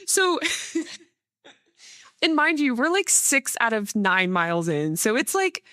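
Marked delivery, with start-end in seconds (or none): 0.0-2.7 higher, laughing through the words; laughing as she goes on. Real, not acted. Laughing while telling story.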